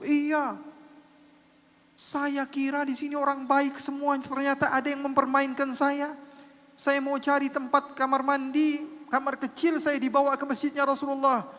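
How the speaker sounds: aggrieved blind man. Retold or imitated. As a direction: complain that you were tricked. Blind man complains to Nuaiman.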